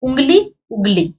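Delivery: उंगली nasal first, then उगली with no nasal; with and without bindi. Shown as a demonstration. Teacher contrasts उंगली and उगली by the nasal. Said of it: "दोनों में फर्क पड़ रहा है".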